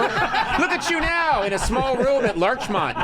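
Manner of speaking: high, drawn-out sarcastic fanfare; laughing, hammed-up congratulations; mock triumph. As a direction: celebrate it grandly through laughter. Mock celebration of a humble career.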